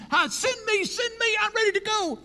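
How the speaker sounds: very high, eager pleading; eager volunteer. Acted. Eager believer begging God to send him. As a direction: beg to be sent right now. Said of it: "who says".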